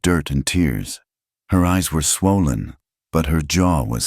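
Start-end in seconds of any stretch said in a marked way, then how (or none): none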